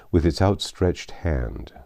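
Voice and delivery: ordinary delivery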